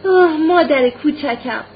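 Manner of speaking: loud, high, slowly drawn-out exclamation; joyful tearful cry. A narrator's reading. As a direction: cry out to mother, overcome with joy. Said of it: "فریادی که آمیخته به خوشحالی و گریه بود". (فریاد کشیدهٔ شادی آمیخته به گریه)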